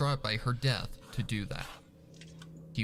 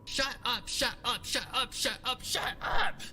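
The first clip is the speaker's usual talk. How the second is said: frantic repeated shutups; 12 semitones higher than usual. A narrator's reading. Rainbow's frantic repeated outburst.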